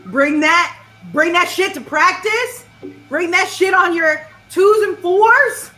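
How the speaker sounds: loud, high marching band commands; hyped-up, punchy rallying shout; drill-leader bark. Acted. Band leader drilling a new recruit.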